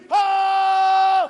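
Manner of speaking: sudden loud high yell of rage; angry shout. Retold or imitated. Angry father yells at his kids, furious shout. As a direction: blast out a furious cry.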